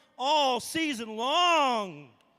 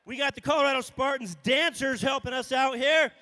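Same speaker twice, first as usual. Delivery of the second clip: normal talking voice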